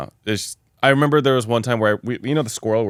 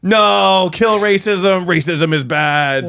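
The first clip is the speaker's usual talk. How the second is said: higher, slow, grandiose righteous voice; mock crusader friend; 8 semitones higher, 5 dB louder, much slower than usual. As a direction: proclaim it like a holy crusade. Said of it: "So he was like".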